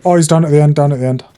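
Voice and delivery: shouting callout. Real, not acted. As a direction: yell the position to the squad. Shouting enemy position to team.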